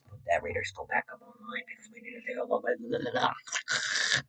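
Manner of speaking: an unvoiced playful whisper. Streamer teasing viewers with a joke, whisper. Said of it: "I was just kidding".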